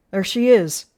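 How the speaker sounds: loud cry to the mob; loud, pointed accusation; accusing shout. A narrator's reading. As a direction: shout it out as you spot her. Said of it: "Trout shouted".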